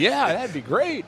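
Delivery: put-on a naive young actor agreeing; mock eager self. His own past reply mimicked.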